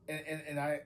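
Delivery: ordinary delivery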